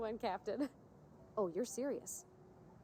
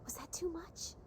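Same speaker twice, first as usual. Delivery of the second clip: unvoiced worried aside; whispering; 8 semitones higher, 5 dB quieter, slower than usual. Real, not acted. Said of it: "asks quietly after acting".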